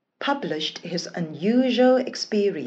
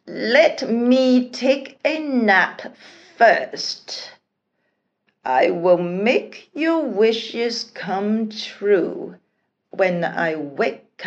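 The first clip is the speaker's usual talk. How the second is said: slow, drowsy genie voice; sleepy genie; 2 semitones higher, 4 dB louder, slower than usual. A narrator's reading. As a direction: yawn the promise out lazily. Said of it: "the Jenny said to the rest".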